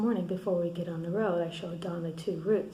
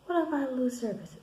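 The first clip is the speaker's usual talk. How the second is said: higher-pitched mock panic; retold panicked protest; 6 semitones higher, 4 dB louder than usual. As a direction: imitate a worked-up objection. Voicing Don in retold quarrel.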